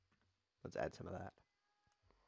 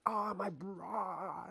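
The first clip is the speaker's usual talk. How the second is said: higher, very slow, strangled gasp; mock stroke victim; 9 semitones higher, much slower than usual. Imagining the opponent collapsing mid-game, slow strangled mock gasp. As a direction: drag it out like a dying man. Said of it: "and he'll be like".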